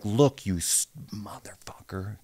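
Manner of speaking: whispered taunt at a teammate; menacing whisper. Retold or imitated. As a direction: hiss it close to his ear.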